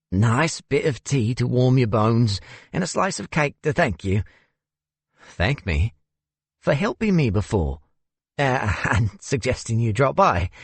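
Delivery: lightly voiced genteel older man; a hint of a South African gentleman. A narrator's reading. Narrator voices Xander.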